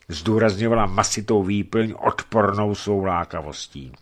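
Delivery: ordinary delivery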